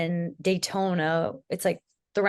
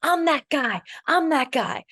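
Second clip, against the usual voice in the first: high, cocky brag; teasing imitation of a bragging partner; mock boasting; 9 semitones higher, 4 dB louder, slower than usual. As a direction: strut it like a show-off. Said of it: "he was like".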